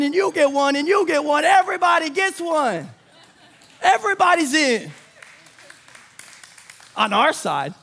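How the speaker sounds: rally the room like a giveaway host; excited preaching. Acted. Excited preaching climax, Oprah bit.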